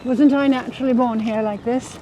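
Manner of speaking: playfully insistent mock-British; put-on British accent. Wife convincing her doubtful husband, put-on British accent. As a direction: claim to be a born Brit, in the accent. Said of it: "sound absolutely British".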